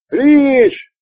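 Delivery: high, loud cry of a name; alarmed shout. Acted. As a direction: call out in alarm. Calling to fallen friend.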